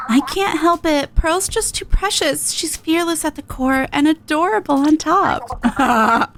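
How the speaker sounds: slow, doting, gushing; Marina, fond. Acted. Marina gushing about Pearl, slow and doting. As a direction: melt over your partner.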